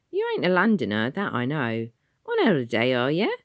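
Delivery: a cheeky city official quizzing a stranger. Acted. Ticket man's voice.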